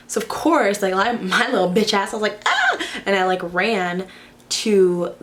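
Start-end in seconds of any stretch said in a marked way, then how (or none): 2.4-2.8 short, high-pitched mock scream; quick squealed "ah", hammed-up for the story; startled scream. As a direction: act out a sudden frightened yelp. Retelling her startled scream.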